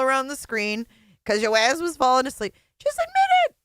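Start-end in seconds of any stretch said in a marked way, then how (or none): 2.8-3.6 a much higher, louder taunt; mocking squeal. Real, not acted. Host taunting the guest over her snore story, mocking squeal.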